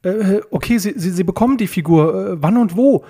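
high, stuttering agreement; worried. A narrator's reading. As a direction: stumble anxiously over the words. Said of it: "Peter war blass vor Sorge".

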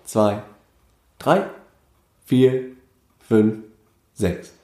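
deliberately slow, separated numbers; very slow count. Shown as a demonstration. Musician shows six-eight needn't be fast, very slow count. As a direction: count one beat at a time with long gaps. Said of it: "man kann ja auch so spielen".

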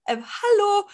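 nachgespieltes nervöses, viel zu hohes Hallo. Retold or imitated. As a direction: imitate a jittery, high-pitched greeting. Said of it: "Ich war so super nervös". (nervös nachgeahmt, mit hoher Stimme)

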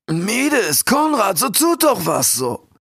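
the king's plea as if heard from afar; call from far away. A narrator's reading. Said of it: "hörte der Kondor seinen König wie aus unendlicher Ferne". (Ruf wie aus weiter Ferne)

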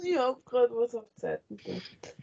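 words broken by giggles; laughing while talking. Real, not acted. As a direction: giggle through the line. Giggling through her own story.

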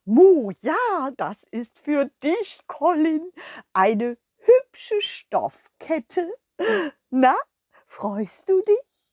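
high, slow mooing cow, full of pride; proud cow. Acted. The cow Kurita proudly giving the dog her present. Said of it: "voller Stolz".

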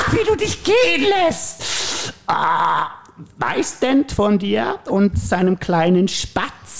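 high, slow mocking villain voice; taunting Joker. Joker taunting the raging Batman, high and mocking. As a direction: sneer at him, savouring it.

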